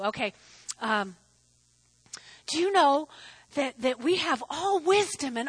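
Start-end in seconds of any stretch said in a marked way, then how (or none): none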